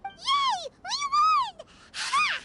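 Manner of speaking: high, loud victory cheer; triumphant cheer. Acted. Paimon's beetle wins the fight, triumphant cheer. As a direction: crow over the win.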